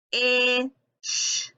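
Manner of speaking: "Ish" held vowel, separate long sh; stretched sounds. Shown as a demonstration. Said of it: "stretching the word out".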